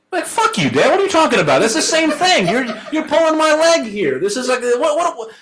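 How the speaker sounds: high, incredulous mock protest with a laugh; mock outraged kid. Kid protesting to his dad, hammed-up high outrage. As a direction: play the kid who can't believe his dad's answer. Kid mocks dad's textualist answer.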